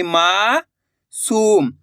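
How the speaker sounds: "मासूम" very slow, long rising मा, pause, falling सूम; drawn-out vowel. Shown as a demonstration. Teacher showing the long vowel sign, "मासूम" drawn out in two parts. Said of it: "खींच के निकल रहा".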